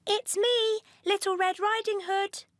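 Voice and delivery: put-on sweet childlike answer; little girl voice. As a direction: call through the door as a small girl.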